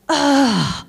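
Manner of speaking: loud fed-up groan as the doors shut; frustrated groan. Retold or imitated. Man on the train missing his stop, loud imitated groan. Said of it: "he's like".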